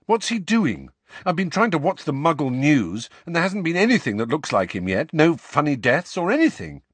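put-on high, rushed, insistent boy; high-pitched, impatient boy; eager questioning. A narrator's reading. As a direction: pour out questions urgently. Harry presses with questions.

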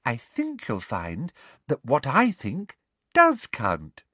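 honeyed condescension; simpering. Acted. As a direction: savour the upper hand sweetly. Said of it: "simpered Professor Umbridge".

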